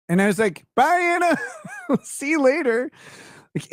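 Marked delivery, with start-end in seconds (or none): 0.8-2.9 very high-pitched farewell broken by laughter; high mock send-off with a laugh; mock cheery goodbye. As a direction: call goodbye way up high, amused. Mock farewell as she storms off.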